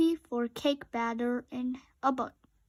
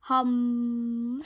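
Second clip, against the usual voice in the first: steady mechanical drone; robot snore hum. A narrator's reading. Robot snoring at the offered batter, mechanical hum. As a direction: hum like a sleeping machine. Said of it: "snored Robot".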